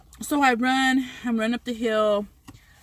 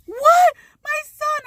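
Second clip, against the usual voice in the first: high, wailing, near-crying mother; crying panicked mom; 8 dB louder, much slower than usual. Retold or imitated. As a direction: wail for your missing son.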